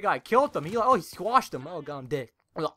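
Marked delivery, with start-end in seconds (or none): none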